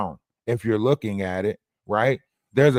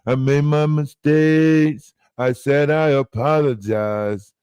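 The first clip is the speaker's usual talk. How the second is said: slow whiny mocking voice; drawn-out mock self-pity; mocking whiny apology; 3 dB louder, slower than usual. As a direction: sneer at their fake remorse. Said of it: "on some weird ass".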